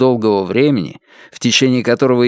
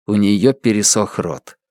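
plain reading voice

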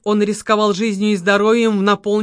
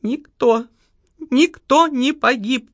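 сквозь рыдание; 4 semitones higher, slower than usual. A narrator's reading. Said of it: "сквозь рыдание".

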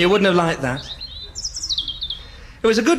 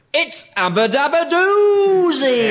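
drawn-out catchphrase; 8 semitones higher, 3 dB louder, slower than usual. Acted. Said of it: "catchphrase sung out".

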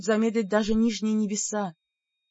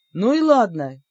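loud defiant outburst; shouted; 6 dB louder than usual. A narrator's reading. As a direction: shout it out in fury. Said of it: "выкрикнул я".

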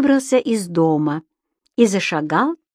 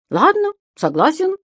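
much higher little creature voice; Субастик соглашается; 10 semitones higher than usual. A narrator's reading. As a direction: agree brightly, pitched way up. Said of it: "ответил Зубастик". (высокий голосок Субастика)

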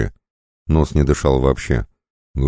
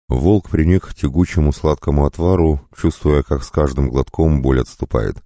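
ordinary delivery

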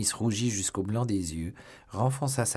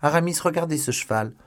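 high, loud call to a friend; excited exclamation; 8 semitones higher, 6 dB louder than usual. A narrator's reading. D'Artagnan shows Aramis the horse, crying out excitedly. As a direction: call out in amazement. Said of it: "s'écria-t-il".

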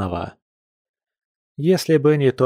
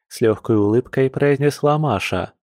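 usual speaking voice

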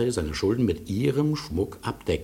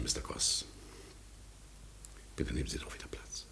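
breath gone, barely voiced; faltering whisper; 8 semitones lower, 16 dB quieter than usual. Maso whispers to Mister Cross, short of breath. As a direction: whisper as if the air won't come.

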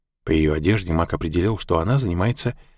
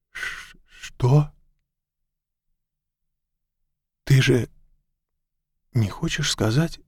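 halting, higher-pitched confusion; bewildered; 5 semitones higher, slower than usual. A narrator's reading. Mack reacts to the name she offers, bewildered and halting. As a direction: stammer it out in disbelief, with long pauses. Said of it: "Мак ещё больше растерялся".

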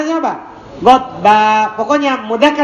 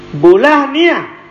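slow, clear model word; 4 semitones higher, slower than usual. Shown as a demonstration. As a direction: spell out "Bulah niyah" slowly for the class. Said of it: "Bidomin fafatin fasukun fakasr". (mencontohkan "Bulah niyah" pelan dan jelas)

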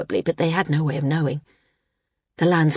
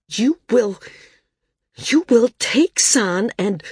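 sputtering; 6 semitones higher, 4 dB louder, slower than usual. A narrator's reading. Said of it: "having difficulty finding words".

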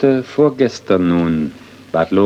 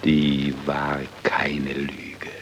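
slow, soft, almost whispered; hushed whisper; 5 semitones lower, 8 dB quieter, much slower than usual. Acted. Judge quietly assures the widow, in a slow whisper. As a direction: breathe the words out gently.

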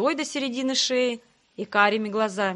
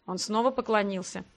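ordinary delivery, nothing marked

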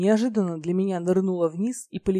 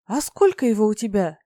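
louder, keenly curious question; open interest; 3 semitones higher, 7 dB louder than usual. A narrator's reading. Goddess tempted by the dragon's gold, keenly interested question. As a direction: ask with undisguised interest. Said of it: "не скрывая интерес".

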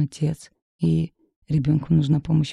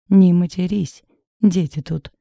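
a sharp, louder shush at a friend; hushing snap; 2 semitones higher, 7 dB louder than usual. A narrator's reading. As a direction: cut her off quickly, scolding. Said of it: "шикнула я на подругу".

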